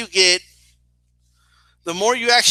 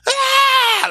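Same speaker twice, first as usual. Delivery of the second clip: high-pitched mock scream; imitated fan scream; 3 dB louder than usual. Cousin as The Rock runs down, screaming. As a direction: shriek like an overexcited fan. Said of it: "And she starts screaming".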